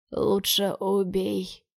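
slow faint rasp through blood; barely audible, choking on blood; bloody mouth. Acted. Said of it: "еле слышно хрипит Дерек".

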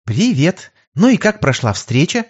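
beaming cheerful. A narrator's reading. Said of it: "Сияя, как начищенный пятак".

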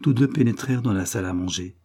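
usual speaking voice

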